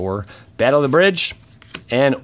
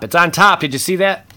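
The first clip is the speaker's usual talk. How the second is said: excited; 8 semitones higher, 6 dB louder than usual. Real, not acted. Excited at the card on top.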